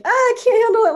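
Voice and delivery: high, loud overwhelmed cry; mock panic. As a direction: act out a comic freak-out, high and loud. Retells being overwhelmed by it.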